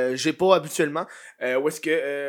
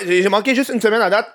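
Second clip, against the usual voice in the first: ordinary talk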